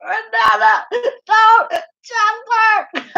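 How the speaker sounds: high, shrill playful cry of protest; mock scream. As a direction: scream as if about to be stabbed. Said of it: "I'm like".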